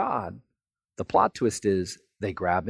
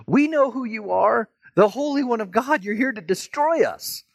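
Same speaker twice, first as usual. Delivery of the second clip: high-pitched, panicked demon outcry; screaming demon; 12 semitones higher, 3 dB louder than usual. Acted. Demon screams at Jesus in church, high outcry. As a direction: cry out high as a cornered demon. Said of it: "where the demon screams out".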